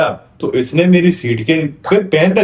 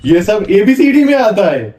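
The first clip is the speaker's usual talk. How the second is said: mounting exasperation; 4 semitones higher than usual. Acted. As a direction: read out, climbing steadily to a high outburst. Teacher reads essay with mounting exasperation. (झल्लाहट में पढ़ते हुए आवाज़ लगातार ऊँची)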